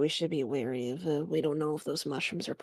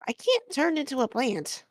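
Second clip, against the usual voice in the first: indignant protest; 9 semitones higher than usual. Acted. Fox protesting dirt idea.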